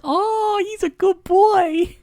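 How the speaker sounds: very high, slow doggy-talk voice; squeaky-high baby talk, drawn out; cutesy pet talk. Acted. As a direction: coo it sky-high like praising a puppy. Gushing over a dog character.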